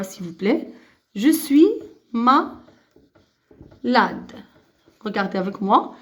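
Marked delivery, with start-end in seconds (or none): 1.2-2.6 broken into pieces with pauses; modelled for repetition. Shown as a demonstration. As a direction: dictate it syllable by syllable to pupils. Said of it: "suivez avec moi".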